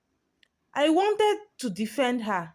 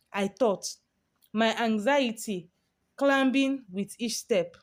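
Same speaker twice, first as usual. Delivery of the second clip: plain reading voice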